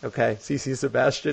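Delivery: mock her mangled "Sebastian" quietly; wrong name, mock. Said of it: "I was just like".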